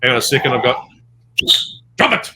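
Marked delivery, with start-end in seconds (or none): 2.0-2.3 sharp command to a pet. Real, not acted. Actor scolds his dog mid-interview, sharp command. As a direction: drop it! snapped high at the dog.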